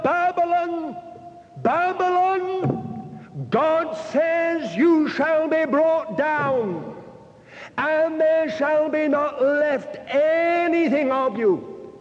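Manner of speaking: put-on high, slow prophet's cry in a Hebrew accent. Preacher voicing a Hebrew prophet crying out in a Hebrew accent. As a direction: call it out like an old Hebrew prophet in a square. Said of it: "in a somewhat foreign accent, a Hebrew accent".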